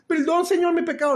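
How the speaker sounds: a parodied cry of repentance; mock pleading. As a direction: ham up a tearful sinner's plea. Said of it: "qué significa arrepentimiento".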